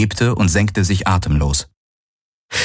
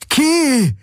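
joyful shout. Acted. Galen calls Ki across the fields, high and joyful. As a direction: call her across the fields.

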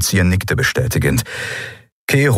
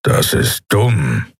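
growling; much slower than usual. A narrator's reading. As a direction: growl it out slowly. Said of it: "knurrte Keru". (langsam geknurrt)

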